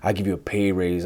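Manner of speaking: low, softly muttered mock apology; behind-the-scenes apology. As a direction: quote it quietly, as if privately placating. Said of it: "all that behind the scenes".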